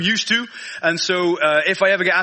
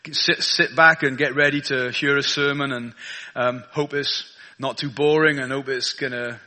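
prayer; 7 semitones lower than usual. Acted. Prays before the sermon.

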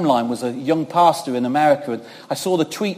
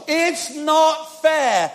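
slow whine; 11 semitones higher, 4 dB louder, much slower than usual. Retold or imitated. Complaint to God, drawn out.

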